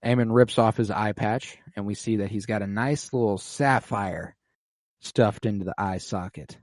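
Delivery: normal talking voice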